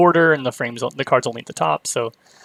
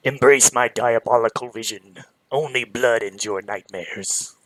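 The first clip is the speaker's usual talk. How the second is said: lightly voiced booming villain monologue; a hint of a gloating villain; 3 semitones lower, slower than usual. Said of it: "read it in a villain voice".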